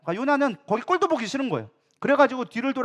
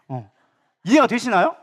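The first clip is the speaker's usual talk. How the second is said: usual speaking voice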